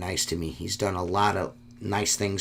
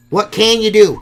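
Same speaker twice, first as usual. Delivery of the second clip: much higher and louder, throwing up your hands; a loud, exasperated what can you do; exasperated shout; 11 semitones higher, 12 dB louder than usual. Real, not acted. Repeats the phrase, then exclaims.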